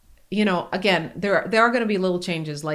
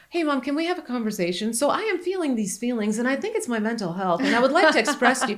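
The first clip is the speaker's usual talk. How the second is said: put-on higher, overly formal teen voice; mock polite teen; 6 semitones higher than usual. Mother mocking the idea of a teen calmly explaining. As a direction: ham up an implausibly calm teenager. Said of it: "expecting this child to come to us and go".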